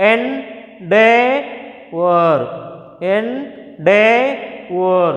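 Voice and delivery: Endeavor, very slow, DEV drawn out; en-DEV-or stretched for the class; slow drill of Endeavor; stress on DEV. Shown as a demonstration. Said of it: "three syllables ఉన్నాయి".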